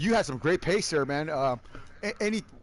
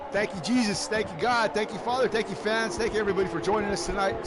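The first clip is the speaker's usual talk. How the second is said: ordinary talk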